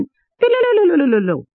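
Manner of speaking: high rapid trill of a rolling coin; quick high rattle imitating a coin rolling. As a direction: let the coin spin away on a rolled tongue. Coin rolls across floor.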